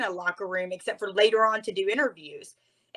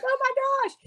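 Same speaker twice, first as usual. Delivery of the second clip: very high, breathless delight; thrilled gasp. Retold or imitated. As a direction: let it burst out in disbelief. Jumping up and down with excitement.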